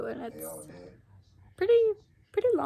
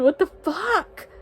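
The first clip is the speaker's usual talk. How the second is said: loud, higher and slow, stretched in disbelief; drawn-out shocked swear; 6 semitones higher, 11 dB louder, much slower than usual. Real, not acted. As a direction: let it hang, stunned. Shocked by book twist.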